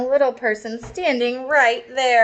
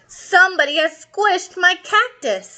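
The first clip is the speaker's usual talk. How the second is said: higher, indignant father; hammed-up dad outrage; upset dad voice; 7 semitones higher than usual. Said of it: "said the daddy person".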